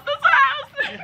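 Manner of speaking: very high, through laughter; helpless giggly outburst; laughing agreement. Real, not acted. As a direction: squeal it while cracking up. Cracks up with the listener.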